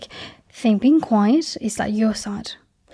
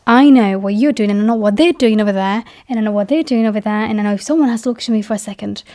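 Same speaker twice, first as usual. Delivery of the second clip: quickening list; faster than usual. Real, not acted. Listing rapidly.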